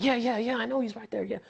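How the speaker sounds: high, hurried dismissive agreement; distracted brush-off. Fan busy with the phone, brushing off bystanders. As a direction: wave them off without listening.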